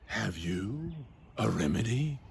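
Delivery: soft, hesitant anxious question. Acted. Slave asking the astrologer for a remedy, anxious. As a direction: ask timidly, with mild worry.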